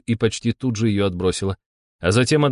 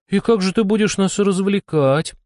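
higher-pitched, pouty character voice; pouting complaint; 10 semitones higher than usual. A narrator's reading. Dolce to Helsin about entertainment, pouting. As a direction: ask it with a pout. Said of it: "надула губки Дольче".

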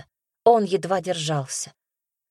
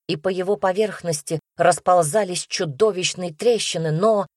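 usual speaking voice

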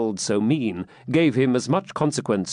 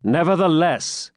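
firm, higher, authoritative; commanding tone; 6 semitones higher than usual. A narrator's reading. Pilgrim overruling the swineherd, commanding. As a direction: lay down the word like an order. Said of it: "said the pilgrim in a commanding tone".

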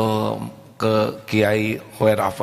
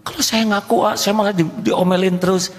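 whiny husband; 11 semitones higher than usual. Acted. Husband complaining about scolding wife.

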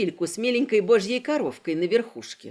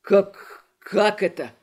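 stunned disbelief; 3 semitones lower, 4 dB louder, slower than usual. Acted. Kristof stunned by the failure.

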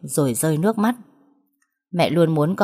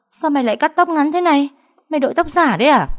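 much higher, louder shocked questions; 10 semitones higher, 4 dB louder, faster than usual. A narrator's reading. Vi stunned by her friend's short hair, shrieking. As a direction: gasp it out in disbelief.

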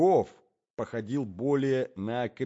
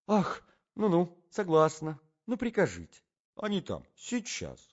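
high, airy lady agreeing; lady's voice; 6 semitones higher than usual. Acted. Lady accepts the offer of a sleigh, high-voiced. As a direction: pitch it up for the lady.

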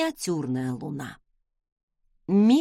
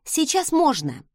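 mildly irritated, pitched-up retort; snappish retort; 5 semitones higher than usual. A narrator's reading. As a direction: answer back with a touch of annoyance. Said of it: "огрызнулась я".

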